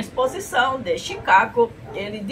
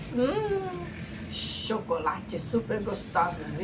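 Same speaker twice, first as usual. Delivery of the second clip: dreamy murmur; 9 dB quieter, slower than usual. Acted.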